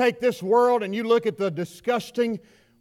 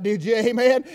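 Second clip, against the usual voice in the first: ordinary delivery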